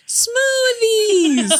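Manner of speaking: very high, drawn-out comic squeal; imitated character's cry. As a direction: squeal it high, mimicking the movie. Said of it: "she's going".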